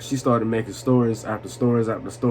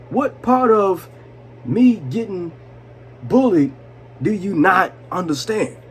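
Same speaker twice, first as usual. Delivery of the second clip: indignant demand; 6 semitones higher, slower than usual. Retold or imitated. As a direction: hammer every word home. Demanding, retold to psychiatrist.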